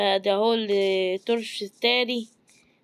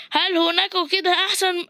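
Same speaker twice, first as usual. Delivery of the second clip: higher, talking through a laugh; 7 semitones higher than usual. Real, not acted. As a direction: let the laugh break into the words. Laughing while searching.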